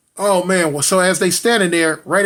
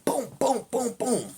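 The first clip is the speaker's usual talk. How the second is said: higher, softer popped booms; imitated gunshots; 7 semitones higher, 8 dB quieter than usual. Storyteller as police hit the brother, soft imitated gunfire. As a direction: mimic shots quietly, pitch raised.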